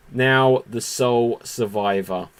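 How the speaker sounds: ordinary talk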